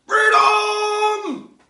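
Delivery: very high, loud shouted cry; mock battle cry. Cat voiced as a rebel, shouting freedom. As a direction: roar it like a rebel charge.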